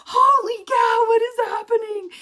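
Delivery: high, loud holy cow; excited amazement. Retold or imitated. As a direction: burst out in high astonished delight. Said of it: "I was like".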